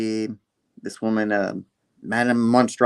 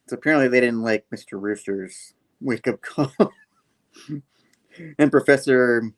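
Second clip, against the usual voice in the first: ordinary talk, nothing marked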